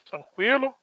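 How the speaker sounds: normal talking voice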